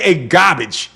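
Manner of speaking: put-on drawled Chicago accent, R dropped. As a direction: say it like a guy from Chicago. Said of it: "I said it like I'm from Chicago".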